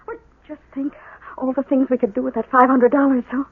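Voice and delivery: hushed aside. Acted. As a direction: keep it hushed so the phone can't hear. Hushed aside during phone call.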